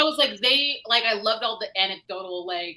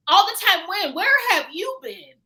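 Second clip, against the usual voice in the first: high, loud disbelieving questions; incredulous mock question; 11 semitones higher, 7 dB louder than usual. Reader answering the book, high and incredulous. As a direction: fire it back in disbelief.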